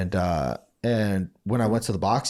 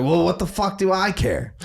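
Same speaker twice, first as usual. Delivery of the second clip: put-on blunt old-school coach, pitched up; high, loud gruff coach; 10 semitones higher, 3 dB louder than usual. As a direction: coach shrugging it off, raised voice. Coach Johnny quoted.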